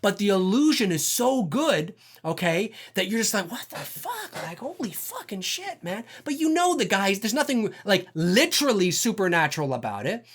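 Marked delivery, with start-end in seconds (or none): 3.5-6.0 much higher, softer gasp of disbelief; breathless awe at the trick; amazed. Retold or imitated. Audience watching a magician, hushed amazement.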